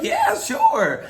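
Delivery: high-pitched, eager yes; retold eager acceptance; eager agreement. Acts out eagerly agreeing to help.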